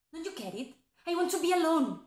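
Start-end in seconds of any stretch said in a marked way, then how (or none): 1.1-2.0 higher, firm outburst; upset insistence. Acted. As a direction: snap that you want solitude. Repeats it after "don't you get it.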